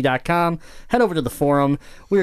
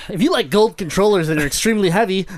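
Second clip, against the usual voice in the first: mock announcer; 7 semitones higher, 4 dB louder than usual. Mock ad parody.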